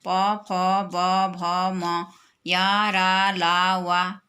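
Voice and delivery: drawn-out letters in an even, measured rhythm; slow alphabet recitation. Shown as a demonstration. Teacher reciting the consonants to children, slow and drawn out. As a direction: recite each consonant long and steady, in groups.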